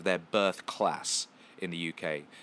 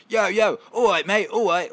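high, loud laddish shout; excited British guys; 12 semitones higher, 12 dB louder than usual. Retold or imitated. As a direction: yell it like rowdy British clubbers. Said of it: "some British guys ... came running over".

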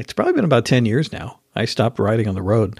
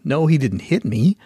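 usual speaking voice

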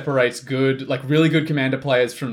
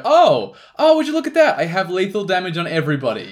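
feigned shock, much higher pitch; fake innocent surprise; 3 semitones higher than usual. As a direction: play innocent, overly surprised. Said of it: "and then they go".

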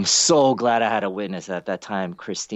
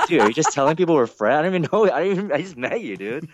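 high, rising disbelief; acted-out baffled protest; mock incredulous; 8 semitones higher, 4 dB louder, faster than usual. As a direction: playfully confront someone in a rush of disbelief. Acted confrontation of false friend claim.

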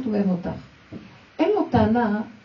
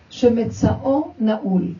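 slow emphatic; 5 dB louder, much slower than usual. Shown as a demonstration. Slow reading of the text.

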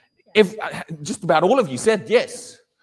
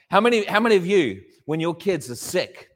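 ordinary talk, nothing marked

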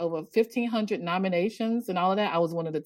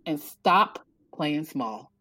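slow, deliberate retold pronouncement; weighty advice; 3 semitones lower, slower than usual. Young woman advising the author to own it, deliberate. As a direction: spread the words out, letting each land.

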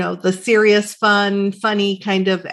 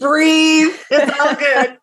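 mock calming; 5 semitones higher, 3 dB louder, slower than usual.

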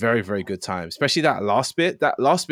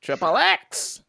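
high, drawn-out rapper ad-lib; 9 semitones higher, much slower than usual. Acted. Showing friends an imitated rapper ad-lib, high impression. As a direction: stretch it out in a high-pitched impression. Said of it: "That was quite a good".

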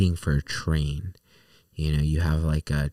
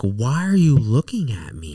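higher and louder, indignant challenge; mock confrontation; 6 semitones higher, 4 dB louder than usual. To the man in the cowboy hat, indignant. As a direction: call him out, offended.

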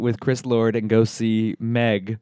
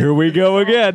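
mocking movie title; 8 semitones higher, 5 dB louder than usual.

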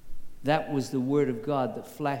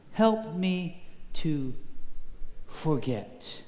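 slow, spaced-out words, falling at the end; slow plea; much slower than usual. Retold or imitated. Preacher repeats the student's answer, slow plea. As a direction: pause before the last word, letting it drop.

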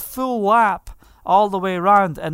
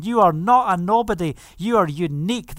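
normal talking voice

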